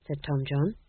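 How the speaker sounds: ordinary delivery, nothing marked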